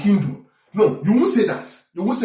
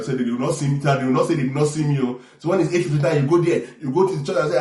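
ordinary talk

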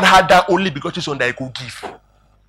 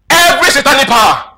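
high, loud shouted prayer imitation; mock prayer shout; 7 semitones higher, 4 dB louder than usual. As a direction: shout it like an overzealous congregation. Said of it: "all of you now shout".

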